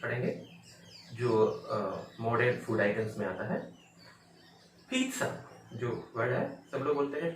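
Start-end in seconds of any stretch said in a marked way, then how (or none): 4.9-5.4 पिज़्ज़ा as high peet-sa; correct pronunciation. Shown as a demonstration. Teacher introducing a modern food word, peet-sa demonstration. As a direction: say पिज़्ज़ा with a ts sound. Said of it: "इसको पढ़ेंगे".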